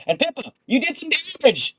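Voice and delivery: squeaky mocking voice, way up high; teasing high voice. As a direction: tease her in a tiny high voice. DM teasing player's damage.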